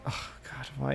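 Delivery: hushed, voiceless mock groan; mock exasperation. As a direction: sigh it out under your breath, as if fed up. Acting out weary exasperation at people.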